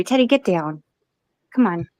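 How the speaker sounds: ordinary talk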